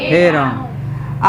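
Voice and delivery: हे राम slow and low with हे held long; drawn-out he ram. Shown as a demonstration. Teacher gives pluta example to class, slow and held. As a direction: stretch हे like a long call. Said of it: "एक और उदाहरण में आता है हे राम".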